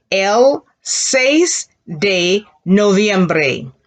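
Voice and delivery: drawn-out, each syllable stretched; very slow classroom demo; very slow model. Shown as a demonstration. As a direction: model it very slowly for learners. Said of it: "Now put that together".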